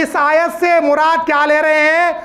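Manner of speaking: ordinary talk, nothing marked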